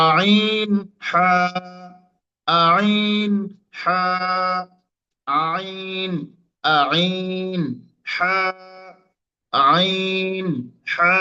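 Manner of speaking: আইন squeezed from the middle of the throat, হা breathy; mid-throat letters demo. Shown as a demonstration. Teacher drills আইন and হা for students, squeezed. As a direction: press আইন from mid-throat, then a clean হা. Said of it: "দেখুন".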